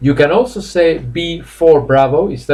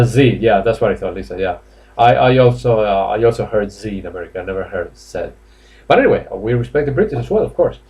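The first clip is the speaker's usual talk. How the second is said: usual speaking voice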